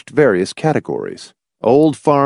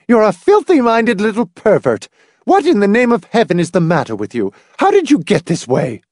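high, louder outraged mother; angry scolding; 11 semitones higher, 4 dB louder than usual. A narrator's reading. Mother shocked by her son's story, high angry scolding. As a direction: berate the child indignantly.

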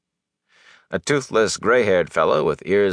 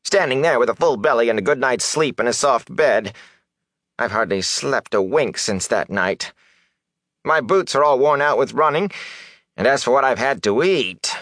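higher-pitched, self-pitying complaint; whining; 6 semitones higher than usual. A narrator's reading. Hungry peddler resenting the well-fed young man, whining. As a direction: grumble enviously about your hardships. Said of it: "Fane whined".

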